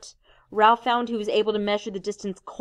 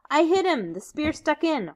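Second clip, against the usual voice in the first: high, eager and proud; excited boast; 8 semitones higher than usual. Acted. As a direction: blurt it out thrilled. Ralph proud after hitting boar.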